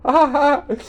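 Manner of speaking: high, loud "ha ha ha"; childlike laugh. A narrator's reading. Swami laughing off the danger of tigers, childlike laugh. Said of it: "He gave a childlike laugh".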